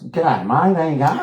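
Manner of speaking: high-pitched, hammed-up Aussie greeting; broad Australian accent. Margot greeting Scorsese, broad Australian. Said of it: "wrong accent".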